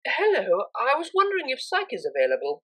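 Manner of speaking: Octavia's voice. A narrator's reading.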